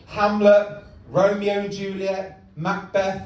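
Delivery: usual speaking voice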